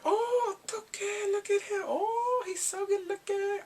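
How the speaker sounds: very high, swooning fan squeal; gushing admirers. Retold or imitated. Schoolgirls admiring the handsome driver, mock high-pitched swooning. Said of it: "Everyone's like".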